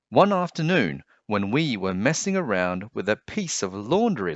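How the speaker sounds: put-on lively storybook reading for kids; story narration. A narrator's reading. Teacher reading a picture book aloud to children, lively storyteller voice. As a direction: read it like bedtime story time.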